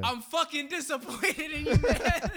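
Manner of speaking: high, laughing mock disappointment; half-laughing scold. Friend scolding the guy on the walk back, laughing mock scold. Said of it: "I remember you just being like".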